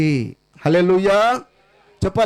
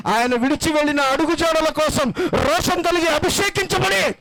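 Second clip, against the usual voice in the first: higher, fervent exhortation; preaching at full force; 7 semitones higher than usual. Acted. Pastor urging mourners to carry on, fervent preaching. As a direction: urge them on, lament turned into a challenge.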